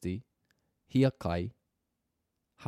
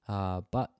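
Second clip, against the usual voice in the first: normal talking voice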